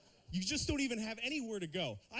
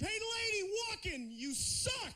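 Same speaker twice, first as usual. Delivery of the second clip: much higher yelled jeer at a pedestrian; shouted insult; slower than usual. Retold or imitated. Driver yelling at a lady walking, shouted jeer. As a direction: yell it out the car window.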